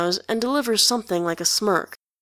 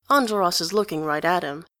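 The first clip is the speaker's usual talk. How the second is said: ordinary delivery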